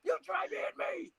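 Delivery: strained exasperation. Acted. God at wits' end with partner, high strangled exasperation. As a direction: squeeze out a high, pent-up mock scream. Acts end of domestic quarrel.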